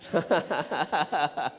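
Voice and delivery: long run of real chuckles; rippling laugh. Real, not acted. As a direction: keep chuckling after teasing someone. Amused, teasing someone present.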